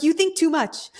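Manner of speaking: an insistent mentor quoting you, voice lifted; Mickey Jacobs quoted. Retold or imitated. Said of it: "she's like, "Kat".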